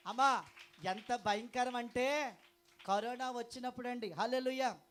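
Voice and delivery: loud, high fiery preaching; full-force preaching. Acted. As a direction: thunder it at the congregation. (గట్టిగా ఉత్సాహంగా ప్రసంగం)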